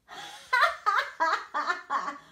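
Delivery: loud, high horse guffaw; proud mocking laugh. Proud horse mocks the snail, loud haughty laugh. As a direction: laugh haughtily at a tiny rival. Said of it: "laughed the proud horse".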